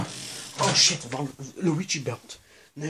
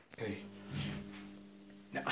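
ordinary talk

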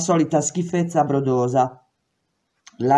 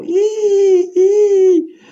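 very high, loud bird shriek "Ih, ih!"; bird call; 7 dB louder than usual. Retold or imitated. Prisoner spooking patrolling cops, shrill bird cry. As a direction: screech it like a startled bird. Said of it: "Gridai di nuovo".

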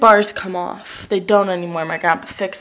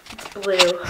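ordinary delivery

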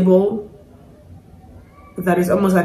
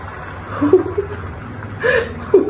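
breathless high giggle; 12 semitones higher, 5 dB quieter than usual. Real, not acted. Overwhelmed by the singing.

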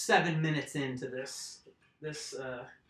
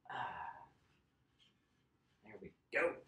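ordinary talk, nothing marked

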